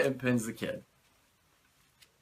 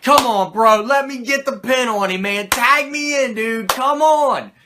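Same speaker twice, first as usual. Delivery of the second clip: loud, high, pleading whine; hyped-up whiny plea for the tag; begging to be tagged in; 9 semitones higher, 9 dB louder than usual. Retold or imitated. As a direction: beg your partner to let you in. Kid begging Razor for tag.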